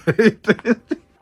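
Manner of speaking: laughing through the words; cracking up as she remembers his face. Real, not acted. Recalling her brother's scared face, laughing.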